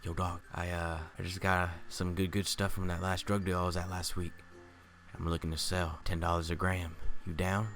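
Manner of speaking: low, soft, laid-back dealer voice; imitating a dealer. Coworker offering weed to the narrator, low easy dealer voice. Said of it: "this is what he literally says to me".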